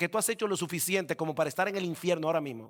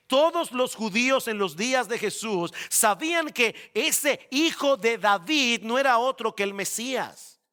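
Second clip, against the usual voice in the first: ordinary delivery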